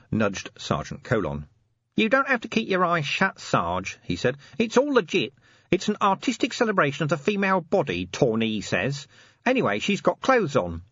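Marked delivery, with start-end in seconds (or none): 1.9-4.0 higher-pitched cheeky reassurance; lightly voiced corporal nudging his sergeant; Nobby, cheeky corporal. A narrator's reading. 4.6-10.8 higher-pitched cheeky reassurance; lightly voiced corporal nudging his sergeant; Nobby, cheeky corporal. A narrator's reading.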